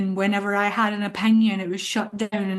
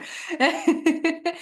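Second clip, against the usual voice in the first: airy laugh, pitched up; high light laugh; 8 semitones higher than usual. Real, not acted. Laugh after quote.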